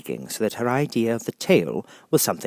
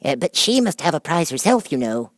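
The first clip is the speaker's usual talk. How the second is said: high, fussy little mouse voice; squeaky Mouse; 9 semitones higher, 7 dB louder than usual. Acted. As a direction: speak as a prim small mouse.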